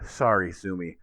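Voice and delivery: normal talking voice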